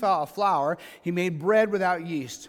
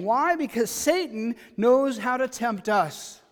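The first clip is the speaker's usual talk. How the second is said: usual speaking voice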